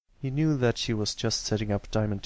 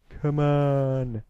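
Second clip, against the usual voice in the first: loud, raised goading call; bullying shout; 4 semitones higher, 5 dB louder than usual. Acted. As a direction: yell it to goad the victim. Bully kicking his victim.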